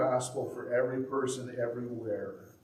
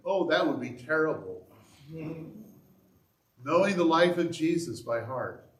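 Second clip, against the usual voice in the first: mock horror, raised voice; sarcastic; 4 semitones higher, 5 dB louder than usual. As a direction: fake dismay, tongue in cheek. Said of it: "That was sarcasm".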